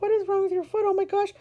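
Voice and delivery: high, fretful alarm; mock worried mom. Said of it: "And I'm like".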